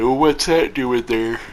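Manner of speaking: talking through a yawn. Real, not acted. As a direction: yawn the words out. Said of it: "Yawning while I'm talking".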